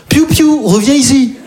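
high sing-song call to a dog; high dog owner call. Retold or imitated. (voix aiguë qui appelle son chien)

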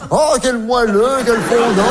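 slow, drawn-out swooning delight; mock rapture. Comic imitating ambassador's guests raving over the chocolates, slow mock rapture. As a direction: stretch every vowel as if melting with pleasure.

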